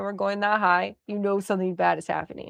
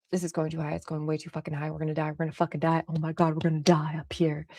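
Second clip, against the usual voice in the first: imitating a friend's soft, panicky muttering, dropping to a whisper; hushed babble of someone terrified of heights; nervous muttering; 3 semitones lower, 7 dB quieter, faster than usual. Renee panicking on the swing ride, nervous muttering. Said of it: "Renee mutters when she's nervous".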